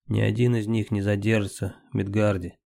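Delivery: usual speaking voice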